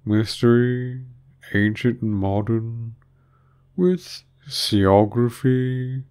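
very slow, drawling recital; doleful Mock Turtle. A narrator's reading. Mock Turtle counting off subjects on his flippers, slow and drawling. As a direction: intone each subject with long stretched vowels.

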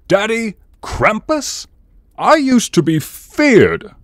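high, loud, slow and outraged, as if insulted; indignant outburst. Acted. Krampus repeating what Cupid said, high, loud and outraged. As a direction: bellow the nickname back in disbelief.

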